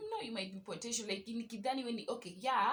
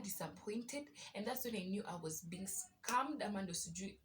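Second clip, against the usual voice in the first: usual speaking voice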